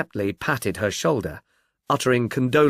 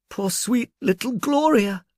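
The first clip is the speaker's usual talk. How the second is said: much higher, slow, mournful; grieving murmur; 10 semitones higher than usual. Acted. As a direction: sigh out her name in sorrow. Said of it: "she murmured".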